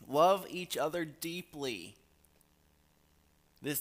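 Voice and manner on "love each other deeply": loud, high and slow, leaning on love; fervent preaching. Acted. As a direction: preach it out over the congregation. Preaching the verse with emphasis.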